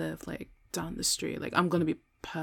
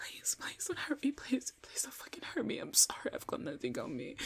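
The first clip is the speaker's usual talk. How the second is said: hushed, whispery begging; terrified pleading; 9 dB quieter than usual. Acted. Victim begging attacker for safety, terrified pleading. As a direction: beg softly in fear.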